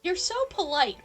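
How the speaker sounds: ordinary talk, nothing marked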